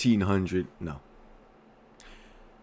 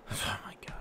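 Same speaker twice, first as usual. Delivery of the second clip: whisper; 14 dB quieter, faster than usual. Real, not acted. Whispered aside.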